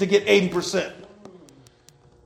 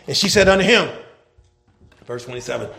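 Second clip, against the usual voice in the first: ordinary talk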